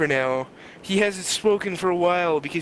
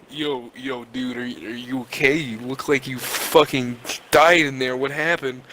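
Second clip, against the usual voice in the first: car driver's voice; 5 semitones lower than usual. Retold or imitated. Retelling the driver finding the injured passenger, low dude voice. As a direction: drop low and check on someone. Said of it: "and he says to me".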